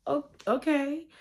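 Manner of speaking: high, lilting surprised okay; a bright realizing oh. Retold or imitated. Accepting pastor's explanation.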